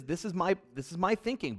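ordinary talk, nothing marked

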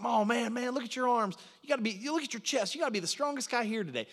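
put-on eager country-accented flattery; Hillbilly. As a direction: imitate a hillbilly buddy gushing. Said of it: "This is how he talks".

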